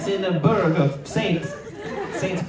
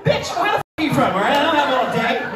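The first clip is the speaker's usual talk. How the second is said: loud, high, fast mock outburst; mock exasperation; 10 semitones higher, 4 dB louder than usual. Comic pressing audience member to say their country, mock exasperated. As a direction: snap impatiently, pitched high and playful.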